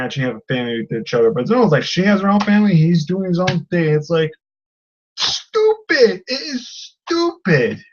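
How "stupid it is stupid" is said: high, drawn-out exasperation; fed-up and incredulous, pitched up; exasperated. Acted. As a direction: groan it out high at the stupidity. Exasperated verdict on the plot.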